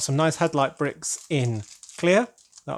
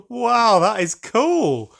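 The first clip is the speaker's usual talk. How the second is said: high, loud, slow "wow, that is cool"; amazed; 7 semitones higher, 5 dB louder, much slower than usual. Real, not acted. Gazing at a gift painting, slow amazed excitement. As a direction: let the awe stretch the words out.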